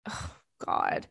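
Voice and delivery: breathy whispered dismay at the smell; whispered oh God. Real, not acted. Reading about the stinky car, a whispered oh God. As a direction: whisper it under your breath, appalled.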